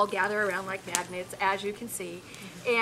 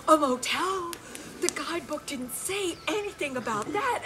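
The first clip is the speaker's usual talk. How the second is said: higher, thrilled disbelief; overjoyed; 8 semitones higher than usual. Retold or imitated. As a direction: hikers thrilled to hear of a motel, delighted. Hikers overjoyed at motel news.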